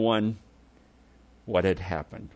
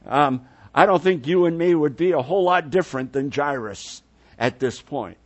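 ordinary delivery

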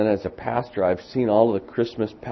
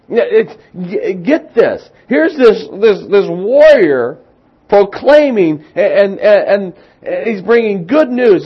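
much higher and louder, building excitement; preaching at full force; 11 semitones higher, 12 dB louder than usual. Acted.

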